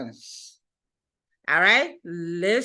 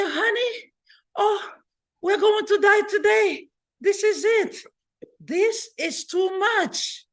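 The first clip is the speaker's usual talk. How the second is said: high, crying panic, much higher; wife sobbing in fear as the boat rocks; scared wife crying; 10 semitones higher than usual. Acted. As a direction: wail it like a terrified wife in the storm. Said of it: "She was so scared that they're gonna drown".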